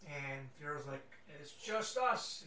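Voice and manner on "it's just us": slow, drawn-out Scottish dwarf; Scottish accent. Acted. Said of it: "He's got a Scottish accent too".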